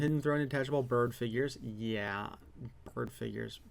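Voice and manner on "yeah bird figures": softer, dry sarcastic air quotes. Real, not acted. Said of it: "I was doing air quotes".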